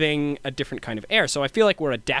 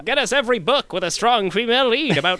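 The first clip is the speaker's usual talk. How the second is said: high, pushed Hollywood producer; brash studio boss pitching; old-timey producer voice; 3 semitones higher than usual. Retold or imitated. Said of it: "I was riffing in my crazy voice".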